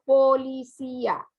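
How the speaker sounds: "policía" split into po-li-cí-a; syllable by syllable. Shown as a demonstration. Teacher showing pupils a hiatus, "policía" syllable by syllable as po-li-cí-a. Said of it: "se separan en sílabas diferentes".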